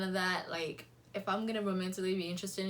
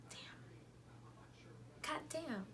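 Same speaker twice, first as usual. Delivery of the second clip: annoyed mutter; 8 semitones higher, 14 dB quieter, much slower than usual. Retold or imitated. Mom calls during flirting, annoyed.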